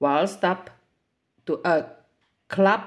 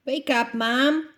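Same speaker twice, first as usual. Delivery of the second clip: calling out; 7 semitones higher than usual. A narrator's reading. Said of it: "he cried".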